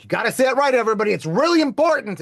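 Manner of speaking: preachy, loud correction; hammed-up scolding of everybody; self-mocking lecture. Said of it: "I've been like".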